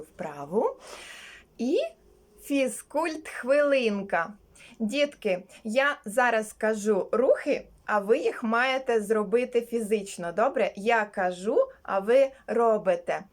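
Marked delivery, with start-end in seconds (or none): none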